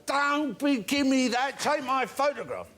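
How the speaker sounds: put-on high, pushy drunken demand, slowing at the end; insistent drunk. Drunk insisting David Bailey take his photo, high and pushy. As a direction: lean on him like a drunk who won't quit. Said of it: "He said".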